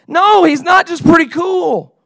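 high, loud objection; exasperated protest. Acted. Leader rejecting a listener's lukewarm reply, exasperated shout. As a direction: shout it in disbelief at the faint praise.

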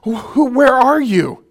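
mock lost soul calling to God; plaintive cry. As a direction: call out like someone lost. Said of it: "when we say things like".

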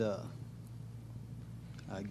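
ordinary talk, nothing marked

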